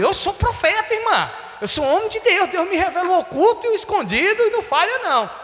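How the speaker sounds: high, grand proclamation; hammed-up prophet. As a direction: boast of being God's prophet, mock-solemn. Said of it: "aí eu falei".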